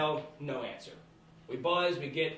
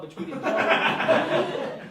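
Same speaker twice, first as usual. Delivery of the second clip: laughing; 9 semitones higher, 7 dB louder, much slower than usual. Real, not acted. Strip club surprise, laughing.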